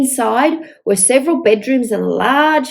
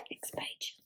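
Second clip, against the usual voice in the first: whispered page cue; 28 dB quieter than usual. Real, not acted. Page-turn whisper to child.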